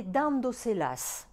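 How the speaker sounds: dándoselas stressed on DÁN; "dándoselas" with weight up front on DÁN. Shown as a demonstration. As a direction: hit the first syllable of dándoselas, slowly. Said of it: "accent sur le A".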